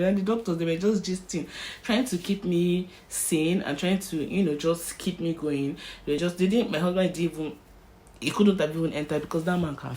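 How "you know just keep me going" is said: usual speaking voice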